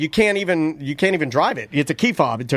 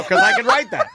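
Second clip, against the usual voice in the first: laughing. Real, not acted. Laughing at own joke.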